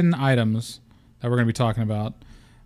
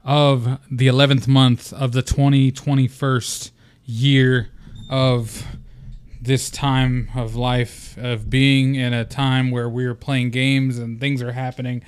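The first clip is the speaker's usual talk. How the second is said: hammed-up podcast intro.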